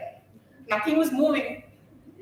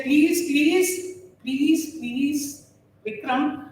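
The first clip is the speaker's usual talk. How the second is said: rising, louder, urgent begging; pleading with Vikram; 4 semitones higher, 4 dB louder, slower than usual. Retold or imitated. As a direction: coax the lander down, pleading harder with each line. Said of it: "I was giving instructions from here".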